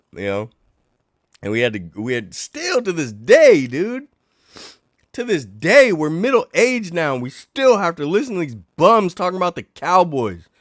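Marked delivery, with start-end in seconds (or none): 2.0-10.5 high, loud venting; exasperated rant. Real, not acted. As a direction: vent years of frustration. Venting about Cowboys fans.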